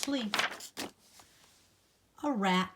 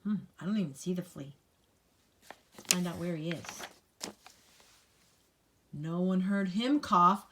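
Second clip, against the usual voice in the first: plain reading voice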